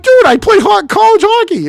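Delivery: very high, loud outraged squawk; indignant protest. Retold or imitated. Son mad at his father's verdict, indignant. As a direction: act out being mad at a put-down. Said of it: "I was so mad".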